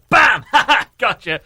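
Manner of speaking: loud, high pounce; mock ambush shout. Nurse surprising a patient with a needle, playful shout. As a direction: burst out with playful triumph. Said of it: "they just went".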